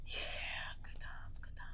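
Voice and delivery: very soft, breath-only aside; whispered reminiscence. Real, not acted. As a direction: whisper it to yourself. Wry reminiscing under the breath.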